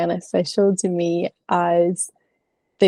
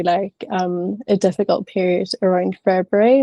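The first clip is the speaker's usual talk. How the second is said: ordinary talk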